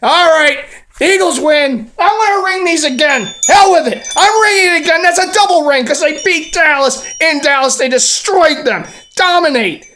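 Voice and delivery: high, loud giddy celebration; hyped. Real, not acted. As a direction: shout it, bursting with joy. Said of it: "I'm a little hyped".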